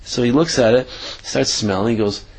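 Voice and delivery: ordinary talk, nothing marked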